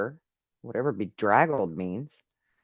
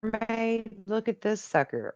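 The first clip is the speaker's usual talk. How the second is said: high, wondering voice; amazed at what he sees; excited; 8 semitones higher than usual. Real, not acted. Excited about a figure on the video.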